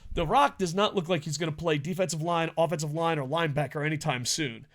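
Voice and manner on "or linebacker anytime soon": ordinary delivery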